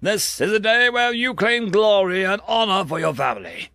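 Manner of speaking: high, projected commander's address, building in force; rally the troops for a charge; rousing battle speech. Acted. Commander sends conscripts against human lines, rousing and forceful.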